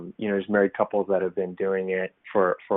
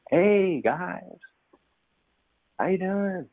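much higher, put-on friendly greeting; Sunday morning voice; 10 semitones higher, much slower than usual. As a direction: ham up the fake church greeter. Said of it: "Sunday morning voice".

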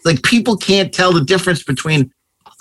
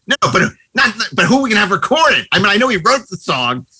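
retold objection; 6 semitones higher than usual. Acted argument in retold story.

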